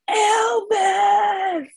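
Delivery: long, high-pitched cry of a stranger; imitated scream. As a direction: holler the name down the street, drawn out. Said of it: "this guy starts screaming".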